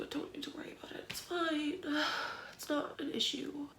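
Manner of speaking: soft whisper through tears, talking herself down; barely voiced, calming herself; tearful whisper. Real, not acted. Tearful mom whispering reassurance to herself, hushed.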